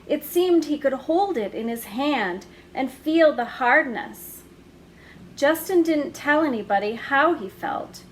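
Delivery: plain reading voice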